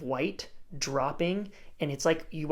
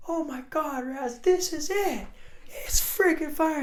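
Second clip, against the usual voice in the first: high-pitched, drawn-out amazement; excited exclamation; 13 semitones higher, 4 dB louder than usual. Retold or imitated. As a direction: re-enact yelling to a friend over huge surf. Retells excitedly greeting friend at waves.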